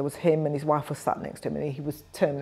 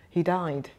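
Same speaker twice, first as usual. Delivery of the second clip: normal talking voice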